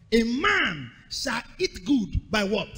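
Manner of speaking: usual speaking voice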